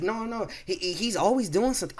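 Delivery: high, whiny imitation of the pregnant girl; mocking pregnant coworker. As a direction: mimic her tattling in a high voice. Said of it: "the pregnant girl's like".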